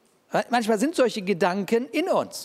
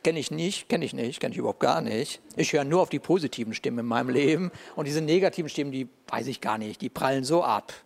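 smug dismissal; 6 semitones lower than usual. Pretend. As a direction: wave it away with mock piety. Parodying a dismissive believer.

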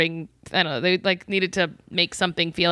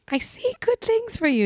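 much higher, airy fortune-teller imitation; mock psychic medium. Host mimics the medium reassuring the daughter, much higher and mystical. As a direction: coo it like a mystic seeing the future. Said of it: "she's like".

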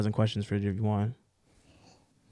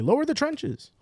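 medieval knight; 13 semitones higher, slower than usual. Pretend. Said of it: "you'd be like".

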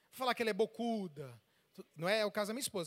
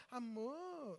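much softer, drawn-out pet name; soft endearment; 9 dB quieter than usual. Shown as a demonstration. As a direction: coo it softly to the wife. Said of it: "eu não preciso falar".

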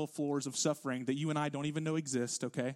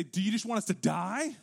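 higher, mildly panicked question; imitated freaking out; 6 semitones higher, 4 dB louder than usual. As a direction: act out alarmed disciples pleading, pitch lifted. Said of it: "The disciples start freaking out".

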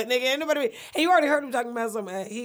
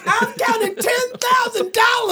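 high, slow mock brag; half-shouted, drawn-out boast; high slow mock bragging shout; 10 semitones higher, slower than usual.

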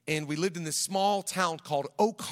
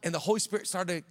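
usual speaking voice

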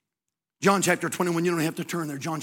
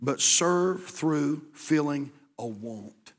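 slow emphatic; much slower than usual. Acted. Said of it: "slow emphatic point".

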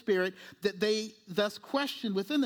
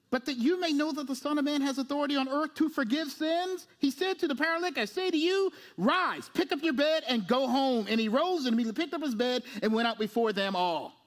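raised, declamatory Bible reading; preaching scripture reading; 6 semitones higher than usual. Acted. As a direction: proclaim the verse with authority.

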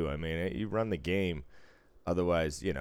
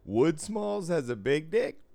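high, slow, incredulous question; surprised disbelief; 11 semitones higher, 4 dB louder, slower than usual. Retold or imitated. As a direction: ask it in total disbelief. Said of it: "And I was like".

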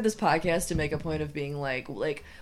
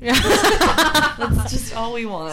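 slow words through laughter; laughing; 5 semitones higher, 8 dB louder, much slower than usual. Real, not acted. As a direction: laugh while drawing it out.

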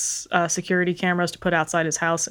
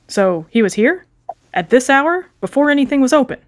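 aggressive questioning; 6 semitones higher, 4 dB louder than usual. Acted. Copper grows aggressive.

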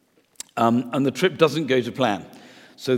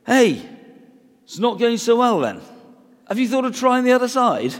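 put-on much higher and louder, a know-it-all kid's voice; cheeky schoolchild advice; 11 semitones higher, 5 dB louder than usual. Preacher mimicking a schoolchild telling fishermen how to fish, high and cheeky. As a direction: chirp it up like a cheeky child butting in. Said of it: "They certainly wouldn't want schoolchild advice".

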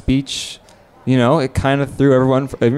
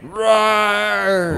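a drawn-out roar, pitched up; big roar; 7 semitones higher than usual. Pretend. Told his roar sounded like a lamb, a bigger roar. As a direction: let the roar out properly this time.

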